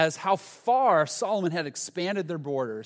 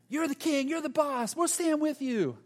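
squeaky, much higher mock cheering; hype-man cheer; 11 semitones higher than usual. Buddies bouncing behind Rehoboam, squeaky mock cheering. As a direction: play a giddy sidekick bouncing behind the king. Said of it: "just bouncing around behind him".

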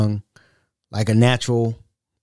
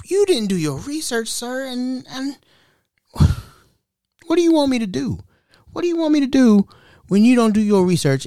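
exasperated; 9 semitones higher than usual. Acted. Scolding the telemarketers.

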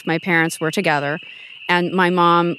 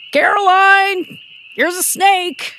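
higher, louder, drawn-out call of "Caroline"; dad calling out; 13 semitones higher, 4 dB louder, slower than usual. Retold or imitated. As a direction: call across the yard like her father. Dad voiced calling her name.

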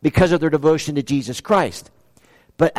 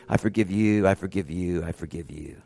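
each I forgive you softer and lower until almost gone; fading forgiveness; 11 semitones lower, 9 dB quieter than usual. Retold or imitated. Preacher voicing Jesus on the cross, fading to a hush. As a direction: let the words drain away like a dying man.

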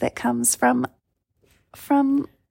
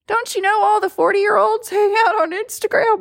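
higher, lightly voiced grandma; 10 semitones higher than usual. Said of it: "that was my grandma voice".

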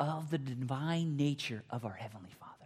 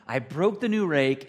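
loud, slow, stricken; panicked child; 2 semitones higher, 7 dB louder than usual. Retold or imitated. Boy panicking over the rake he just broke, loud and slow. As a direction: blurt out the disaster. Same words panicked, then tearful.

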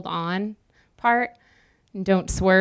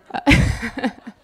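laugh; 4 semitones higher, 4 dB louder than usual. Real, not acted. Laughing at her own joke.

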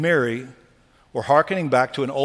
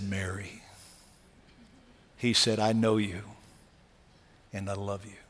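tender; 5 semitones lower, 8 dB quieter than usual. Acted. Jesus tenderly recognized by Mary.

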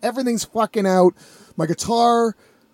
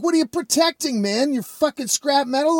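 high-pitched incredulous retort; mock the idea in exasperated disbelief; acted indignant scorn; 7 semitones higher than usual. Retold scornful reply to attacker.

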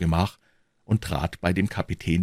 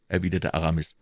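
ordinary delivery

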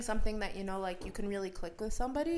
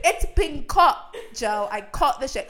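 loud mock-British retort; Freya arguing back; 4 semitones higher, 13 dB louder, slower than usual. Girlfriend insists to boyfriend it's been cut, loud British protest. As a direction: fire back loudly in a put-on British accent. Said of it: "And then Freya was like".

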